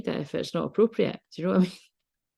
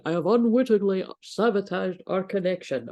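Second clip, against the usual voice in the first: posh voice; 3 semitones higher than usual. Shown as a demonstration. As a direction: read the card line in a hammed-up posh accent. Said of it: "I want to put in a posh voice".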